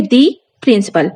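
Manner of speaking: दी said as "dee", stressed, before principal; teacher shows stressed दी before principal; "dee" before principal. Shown as a demonstration. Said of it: "आप उसको कैसे बोलोगे".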